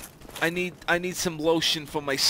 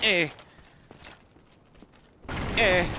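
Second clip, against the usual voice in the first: ordinary delivery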